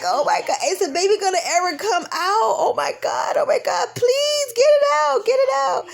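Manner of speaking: high, rising anguished wail; anguished plea. Retold or imitated. Mother in labor pleading for the baby to come out, high anguished wail. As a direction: beg for it to be over.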